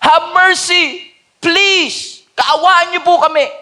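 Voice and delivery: high loud desperate cry for mercy. Retold or imitated. Blind man shouting to Jesus, high loud desperate cry. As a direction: shout it up high, begging to be heard. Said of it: "humihingi ng konting awa".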